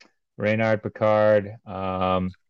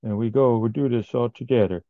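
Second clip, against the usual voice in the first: put-on Mexican accent; 3 semitones higher than usual. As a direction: quote Ray in a Mexican accent. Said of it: "my Mexican accent".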